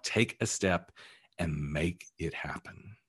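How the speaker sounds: usual speaking voice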